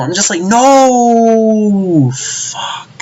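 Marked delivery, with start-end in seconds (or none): 0.4-2.1 long, high drawn-out wail; mock anguished cry. Fan reacting to Goku's mercy, mock anguished cry. 2.1-2.9 soft, breath-only curse; deflated whisper. Retold or imitated. Fan giving up on Goku, deflated whisper.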